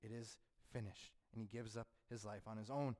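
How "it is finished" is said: solemn final words. Acted. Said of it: "he says".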